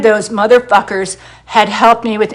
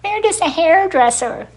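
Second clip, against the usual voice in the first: higher, sneering put-on "We're just a hairdresser"; mocking critics; 6 semitones higher, 3 dB quieter than usual. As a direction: parody condescending people. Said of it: "people saying".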